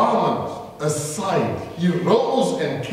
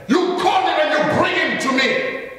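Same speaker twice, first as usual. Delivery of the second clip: higher, ringing command; commanding Jesus; 9 semitones higher, 3 dB louder than usual. Acted. Jesus ordering the crowd to bring the beggar, commanding. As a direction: order the crowd to fetch him.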